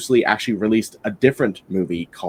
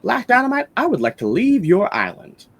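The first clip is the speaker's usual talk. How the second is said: Jim Kelly; 11 semitones higher than usual. Retold or imitated. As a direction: imitate a film hero's formal request, pitched high. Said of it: "Jim Kelly says".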